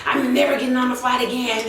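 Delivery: a hint of a frantic passenger, quick; lightly mock her panicked vow; light, fast imitation of the panicking passenger; imitating the lady. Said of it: "she's like".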